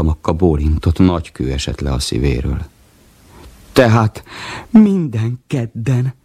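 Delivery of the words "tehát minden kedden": very slow, high delighted drawl; beaming. Acted. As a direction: savour it with glee. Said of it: "virul Vacska Mati".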